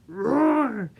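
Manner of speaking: big loud roar; lion roar. Pretend. Teacher roaring for the kids in the song. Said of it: "with a roar".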